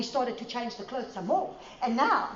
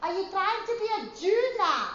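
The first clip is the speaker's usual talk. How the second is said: mocking quote; 8 semitones higher than usual. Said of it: "they say".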